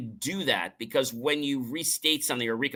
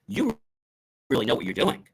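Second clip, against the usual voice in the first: confidence. Shown as a demonstration. Speaker contrasting two meanings, confident praise. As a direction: say it with full trust in them. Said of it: "confidence, or".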